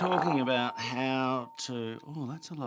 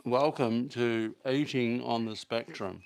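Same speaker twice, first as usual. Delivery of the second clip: mock show intro; 4 dB louder than usual.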